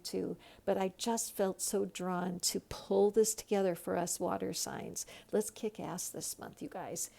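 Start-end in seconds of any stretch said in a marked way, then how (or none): none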